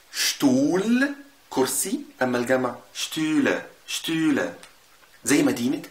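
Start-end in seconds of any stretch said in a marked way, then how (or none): 0.1-1.1 شتول drawn out slowly with a long u; شتول ممدودة ببطء; slow German Stuhl. Shown as a demonstration. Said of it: "بص عندك". (نطق شتول ببطء للتعليم)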